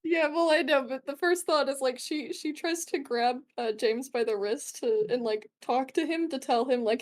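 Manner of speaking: normal talking voice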